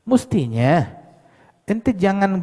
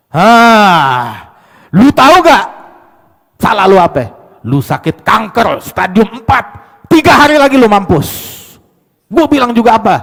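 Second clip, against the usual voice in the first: loud, high brutal doctor; rude doctor; 6 semitones higher, 11 dB louder than usual. Retold or imitated. Harsh doctor shouting cancer news at patient, loud. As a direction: bark the diagnosis at him.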